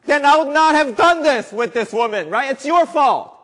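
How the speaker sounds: high, loud whining at God; mock excuse-maker. Preacher mocking a sinner who blames God, high and loud. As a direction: whine the excuse like a caught schoolboy.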